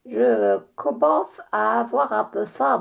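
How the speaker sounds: nasal, stuffed-up voice, slow; blocked nose. Acted. Lola with her trunk knotted, talking with a blocked nose. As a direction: talk as if your nose is knotted shut. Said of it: "Pourquoi elle parle comme ça".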